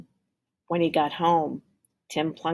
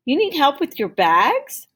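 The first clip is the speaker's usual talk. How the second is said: put-on raised, louder kindly offer to the boys; can man offering help; 10 semitones higher, 7 dB louder than usual. Can man offers to carry Tim's bags, bright higher call.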